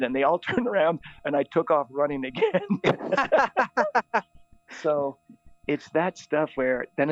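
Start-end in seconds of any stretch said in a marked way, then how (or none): 2.8-4.2 high, rapid giggling laugh; amused laugh. Real, not acted. Priest after fooling the kids with a decoy, amused laugh. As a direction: crack up at your own trick.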